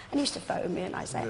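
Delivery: usual speaking voice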